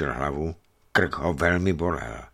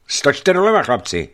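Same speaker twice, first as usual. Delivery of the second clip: loud raised command, dropping at the end; worried call; 7 semitones higher, 7 dB louder than usual. A narrator's reading. Captain orders his men to steer left, calling out anxiously. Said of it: "zvolal a znepokojen".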